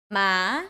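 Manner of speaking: MA rising in pitch; second tone. Shown as a demonstration. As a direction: let "ma" climb upward. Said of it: "second tone".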